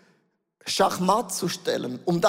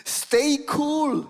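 a light take on the boat guide, slow then higher; guide's reassurance; 6 semitones higher, slower than usual. Retold or imitated. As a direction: soothe the tourists, laid-back and easy. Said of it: "er hat uns gesagt". (der Bootsführer beruhigt die Touristen, lässig und entspannt)

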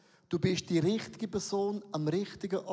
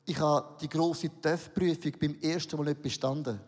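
ordinary delivery

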